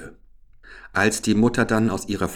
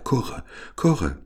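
cooing wood pigeons; 5 semitones higher than usual. A narrator's reading. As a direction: lightly imitate a pigeon's call. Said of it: "Da sagten die Waldtauben".

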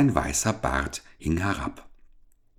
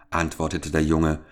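ordinary delivery

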